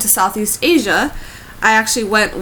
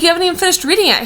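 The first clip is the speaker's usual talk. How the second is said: fast flustered protest; 9 semitones higher, 3 dB louder, much faster than usual. Retold or imitated. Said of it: "I'm like".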